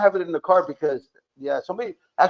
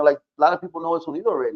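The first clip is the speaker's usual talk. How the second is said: ordinary talk, nothing marked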